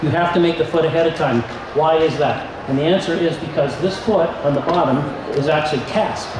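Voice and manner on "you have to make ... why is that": ordinary talk, nothing marked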